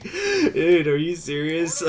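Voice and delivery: hammed-up incredulity; mock incredulous. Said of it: "He pretty much just like".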